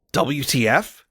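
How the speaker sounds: usual speaking voice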